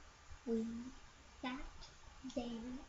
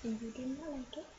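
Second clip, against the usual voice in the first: rushed reading; much faster than usual. Real, not acted. Child reads fast.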